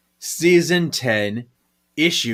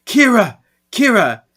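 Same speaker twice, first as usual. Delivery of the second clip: higher, louder calling out a name; mock yell; 10 semitones higher, 8 dB louder than usual. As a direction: call "Kira" urgently across the room. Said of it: "you hear Prue yelling".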